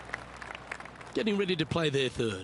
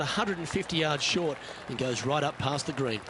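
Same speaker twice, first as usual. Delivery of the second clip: calm, measured broadcast commentary; golf commentator; 3 semitones lower, 5 dB quieter than usual. Acted. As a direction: call the shots like a golf broadcaster. Game commentator calls the shots.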